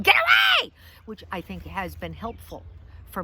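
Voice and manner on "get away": high, full-blast scream; comically over-the-top shriek; mock scream. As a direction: scream it out to scare people off. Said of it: "hold it in front of people and scream".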